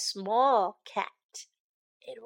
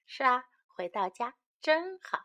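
ordinary delivery, nothing marked

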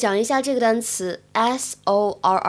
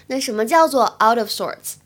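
ordinary talk

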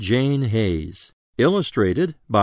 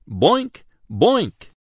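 higher springy boinks; bouncy boink; 7 semitones higher than usual. A narrator's reading. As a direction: bounce the sound like a ball. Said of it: "A bouncy ball goes boink".

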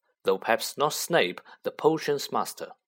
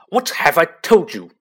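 loud booming shout; thundered; 5 semitones higher, 11 dB louder than usual. Acted. Uncle thunders at Harry for saying magic, booming shout. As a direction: thunder it at the boy. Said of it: "thundered his uncle".

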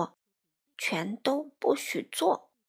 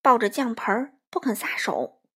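usual speaking voice

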